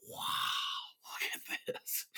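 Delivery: hushed, drawn-out whispered amazement; awed whisper. Retold or imitated. Newcomer walking into the legend's studio, awed whisper. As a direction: whisper it slowly, as if awestruck.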